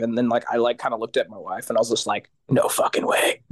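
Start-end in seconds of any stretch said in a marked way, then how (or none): 2.5-3.4 breathless whisper of disbelief. Retold or imitated. Stunned singer whispering to his wife in disbelief.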